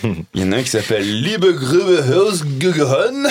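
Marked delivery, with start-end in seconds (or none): 1.2-3.3 hammed-up German accent on the titles; playful fake German accent; mock German accent. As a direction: read the German titles like a proud German. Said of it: "j'ai un super accent allemand".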